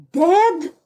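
shocked cry. A narrator's reading. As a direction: cry it out in disbelief. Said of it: "I cried loud enough for her to hear".

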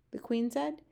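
ordinary delivery, nothing marked

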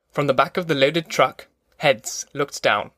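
ordinary delivery, nothing marked

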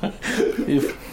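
squeaky laugh through the word; giggling as she speaks. Real, not acted. Laughing at the clone joke.

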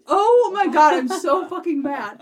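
play-acted outrage; mock fury. Fiance scolding the speaker, mock angry. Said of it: "And she's just like".

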